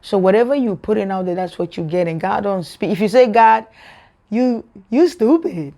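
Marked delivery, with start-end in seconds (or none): 3.3-5.7 drawn-out, animated mock insult; excited insult. Shown as a demonstration. Someone telling God off, excited and mocking. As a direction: stretch out the teasing insult with excitement.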